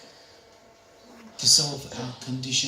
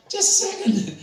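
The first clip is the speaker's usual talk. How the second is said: put-on old lady voice; 12 semitones higher, 4 dB louder than usual. Elderly lady voiced in story.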